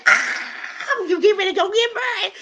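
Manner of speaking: very high, fast, half-laughing squeal; squealing excitement. Retold or imitated. Young bride repeating granddad's blessing, high laughing squeal. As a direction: shriek with joyful disbelief.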